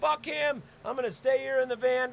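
high, heated refusal; acted stubborn, high-pitched outburst; so mad. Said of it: "I'm just so mad".

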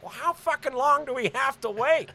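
a Kermit-like cartoon voice for dad; lightly voiced cartoonish dad, annoyed; dad as Kermit the Frog, impatient; dad impression. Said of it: "where I do impressions of my dad".

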